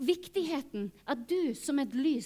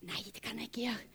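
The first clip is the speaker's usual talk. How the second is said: hushed frightened refusal; whispered, scared to obey; 6 semitones lower, 10 dB quieter than usual. Retold or imitated. Whispering no to the Holy Spirit, afraid.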